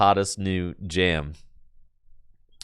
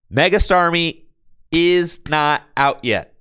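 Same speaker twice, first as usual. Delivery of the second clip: loud, high, slow insistence; raised, deliberate correction; emphatic correction; 7 semitones higher, 4 dB louder, slower than usual. Real, not acted. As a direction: drive it home to the chat, word by word. Repeats the correction to chat.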